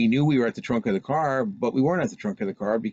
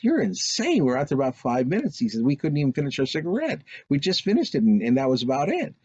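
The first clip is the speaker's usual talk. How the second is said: crank it up high, can't believe it; incredulous protest; 7 semitones higher than usual. Retold or imitated. Brother argues with the girls in high disbelief. Brother disputing missing time.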